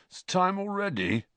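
high, sleepy protest; half-asleep complaint; groggy. A narrator's reading. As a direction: groan it out as if just woken. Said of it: "said Fred groggily".

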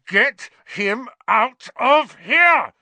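slow, high, full-force bellow. A narrator's reading. Boy clawed by the cat, bellowing. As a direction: bellow each word out, high and drawn out. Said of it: "Ron bellowed".

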